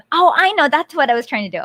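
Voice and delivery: usual speaking voice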